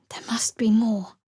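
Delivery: hushed unvoiced whisper. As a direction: breathe it to yourself. Longing inner thought.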